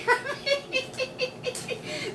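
high, soft giggling fit; high tickled laughter; delighted giggle. Real, not acted. Laughing at baby banging gums.

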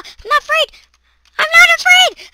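high frantic yell of false bravery; panicked shout. Real, not acted. Gamer shrieking at monsters, panicked shout. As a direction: shout it to convince yourself.

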